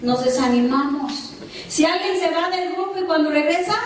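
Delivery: ordinary talk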